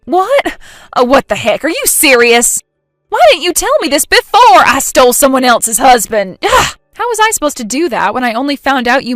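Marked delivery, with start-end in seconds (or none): 0.0-0.6 a higher, sharp startled "What?". Acted. Stunned by the mix-up. 1.0-6.4 louder, indignant complaint; outraged. Acted. Jen outraged at the mix-up. 6.4-6.8 a high, loud exasperated "ugh"; frustrated groan. Acted.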